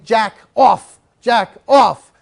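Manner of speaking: loud commanding yell of Jack, off; retold yell. Owner yelling at her dog in a dog fight, loud commands. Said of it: "And she's screaming".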